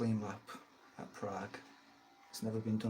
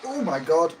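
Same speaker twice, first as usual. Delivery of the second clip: loud startled cry; shocked yell; 6 semitones higher, 10 dB louder than usual. Real, not acted. Near crash into wall.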